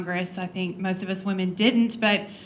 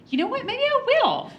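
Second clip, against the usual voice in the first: much higher, spirited mock dare; tossing the challenge back at him, pitch jumping up; defiant comeback. Wife answering husband's dare with a high, spirited comeback. Her reply to Luke's challenge.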